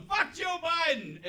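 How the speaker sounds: high, drawn-out mock shout; repeated high-pitched crowd yell, not at full volume; heckler's yell. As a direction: imitate a heckler hollering it slowly. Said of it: "this guy yells up".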